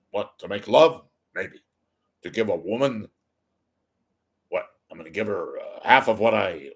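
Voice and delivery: imitated New York Catskills comic, punchy questions; Jackie Mason. Comedian telling the host why he won't marry, mock New York accent. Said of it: "he used to talk like this".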